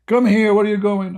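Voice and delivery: high, loud bossy wife calling him in. Retold or imitated. Wife ordering husband to bed, high and loud.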